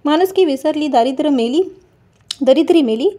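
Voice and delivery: usual speaking voice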